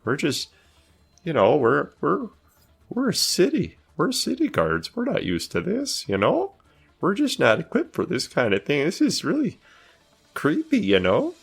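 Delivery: high, anxious squeak; nervous guard voice. Acted. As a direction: play a guard spooked out of his depth. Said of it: "it's just like".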